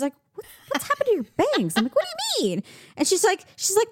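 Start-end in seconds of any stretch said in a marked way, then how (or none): 0.3-1.7 high, startled friend voice; mock alarmed friend. Friend spotting burnt bangs, high startled squeal. Retold friend noticing hair. 2.0-2.6 squeaky baffled question; high confused reply. Retold or imitated. Answering friend about her hair, high and baffled.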